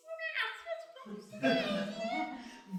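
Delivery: high-pitched mock animal noise; high wordless creature yowl; imitated animal sounds. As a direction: imitate a vague animal call. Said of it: "vague simulacrum of animal sounds".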